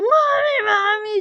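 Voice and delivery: high, pleading cry of Mami; crying call for mom. Retold or imitated. A girl freed from the ghost cries out to her mother, high and pleading. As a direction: call out to mom, half sobbing. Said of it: "y lloré, y lloré".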